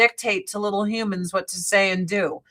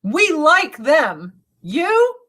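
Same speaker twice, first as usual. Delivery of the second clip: indignant retort; 5 semitones higher, 5 dB louder than usual. Retold or imitated. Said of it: "I'm like".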